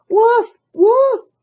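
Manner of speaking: high, loud mock barking; barking like a dog. Cat fakes barking at the birds, high and loud. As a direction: fake a dog bark to scare them. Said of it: "he cried".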